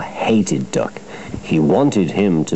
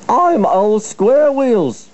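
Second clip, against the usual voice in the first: slow, high-pitched wail of outrage; fuming; 13 semitones higher, 4 dB louder, slower than usual. A narrator's reading. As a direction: fume in a high, drawn-out voice. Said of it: "fumed Henry".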